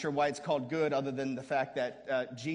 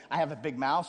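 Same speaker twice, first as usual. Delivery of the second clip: normal talking voice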